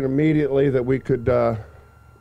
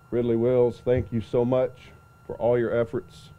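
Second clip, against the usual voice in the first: ordinary talk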